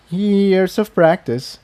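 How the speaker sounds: sheepish. A narrator's reading. Jigsaw shyly deflecting praise from the two mares, sheepish. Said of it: "he said sheepishly".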